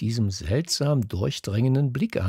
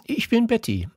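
put-on much higher, timid young girl's voice; hesitant; 13 semitones higher than usual. A narrator's reading. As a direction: hold back a little, say it shyly and much higher. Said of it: "antwortete sie zögernd".

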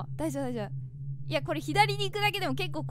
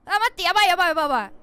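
alarmed repeated yelps; 7 dB louder, much faster than usual. Real, not acted. Sky turns red in game.

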